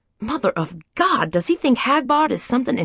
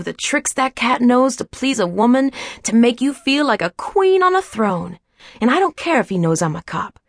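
plain reading voice

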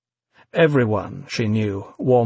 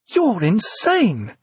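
incredulous; 9 semitones higher, much slower than usual. A narrator's reading. Evie shocked at V.

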